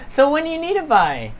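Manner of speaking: high, nervous shop-worker question; slightly fearful. Acted. Shop worker asks the customers about their deadline, slightly fearful. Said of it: "Do you see a little fear in her eyes?".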